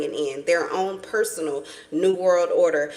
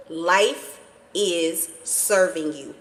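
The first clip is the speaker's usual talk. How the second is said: slow emphatic; much slower than usual. Real, not acted. Repeats the line, slowed right down.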